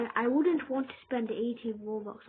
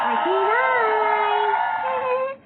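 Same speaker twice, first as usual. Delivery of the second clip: very high, very loud, stretched cry; excited shout; 9 semitones higher, 14 dB louder, much slower than usual. Real, not acted. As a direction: scream it out with joy. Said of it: "I should have said it like that".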